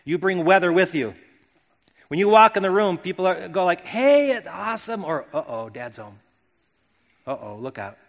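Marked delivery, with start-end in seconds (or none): 3.8-5.0 higher, drawn-out cheery welcome; acted happy cheer; excited greeting. Cheerful greeting when someone enters room. 5.3-8.0 low, hushed uh-oh; acted dread, kept soft; wary warning. Family warily notes dad arriving.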